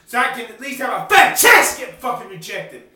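loud angry yell at the end; furious shout. Real, not acted. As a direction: shout the unfairness out. Furious about music career.

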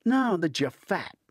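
high, loud playful jab at a friend; teasing. A narrator's reading. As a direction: rib him with a grin in the voice. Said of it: "Hunter teases".

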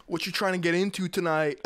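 usual speaking voice

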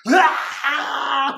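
short mock cry of a hurt kid; imitated child's cry. Preacher imitating a hurt child crying out for a parent. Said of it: "You hear a little kid shriek".

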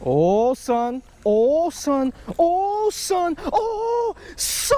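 rising, high, squealing cries of joy over the catch; excited yelps. Real, not acted. Angler thrilled by the catch, yelping in excitement. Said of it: "Sometimes I get too excited".

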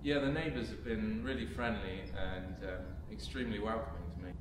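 quiet and hushed. Acted. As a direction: drop to a wary hush. Uneasy about neighbours.

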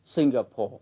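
Singapore with the weight on SIN; SIN-ga-pore, first syllable stressed. Shown as a demonstration. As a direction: hit SIN in Singapore, let the rest fall away. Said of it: "trọng âm rơi vào âm tiết thứ nhất".

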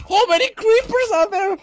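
very loud, very high shriek; real high-pitched panic yell; panicked shouting. Real, not acted. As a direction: scream it in alarm at the swarm. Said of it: "I'm sorry for shouting".

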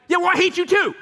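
high, loud mock snap back; a friend yelling back after hearing I hate you; snapping back. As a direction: fire it straight back at the friend. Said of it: "you're like".

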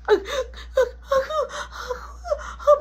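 drawn-out high wheezing gasps; choking gasps. Acted. Choked kid trying to answer mama, hammed-up gasping. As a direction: struggle comically for breath.

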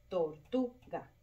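"tortuga" slowly split, tor-TU-ga; stress on TU. Shown as a demonstration. Teacher showing the tonic syllable, "tortuga" split with TU stressed. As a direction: separate the beats of "tortuga", lean on TU. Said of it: "la sílaba tónica".

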